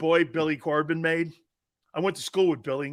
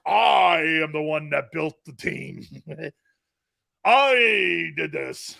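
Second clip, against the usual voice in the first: slow, louder bragging toy voice; mock talking toy; 2 semitones lower, 3 dB louder, slower than usual. The clapped Howie bear bragging, slow mock boast. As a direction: drag it out like a boastful doll. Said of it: "the Howie bear goes".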